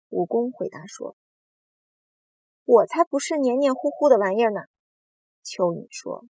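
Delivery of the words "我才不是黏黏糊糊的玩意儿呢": louder, higher indignant retort; indignant denial. A narrator's reading. Earthworm rejecting the slimy insult, indignant. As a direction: protest it forcefully.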